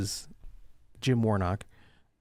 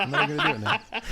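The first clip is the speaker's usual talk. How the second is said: laughing. Real, not acted. Refusing while laughing.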